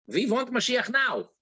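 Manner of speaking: put-on light Russian accent, W as V; a hint of a Russian-accented chant. The Rebbe chanting in English with a light Russian accent. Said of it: "And he, in English".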